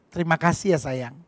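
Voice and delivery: soft, low tender thanks. Shown as a demonstration. Husband thanking his wife, soft and low. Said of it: "boleh dong kita bilang".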